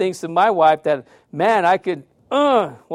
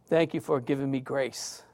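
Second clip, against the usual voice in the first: much softer, hushed thanks to God; quiet grateful prayer; 5 semitones lower, 11 dB quieter than usual. Retold or imitated. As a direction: breathe the thanks as a private prayer. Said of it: "And I thought".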